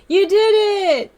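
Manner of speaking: high, slow cooing praise; delighted pet-praise; excited praise. Real, not acted. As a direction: cheer the pet on.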